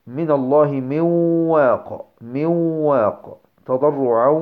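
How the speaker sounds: "من واق" as "miwww-waaq", long nasal waw; merge into waw. Shown as a demonstration. Teacher giving examples of the waw, merged with ghunnah. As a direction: recite slowly, holding the merged waw in "من واق". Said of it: "الواو".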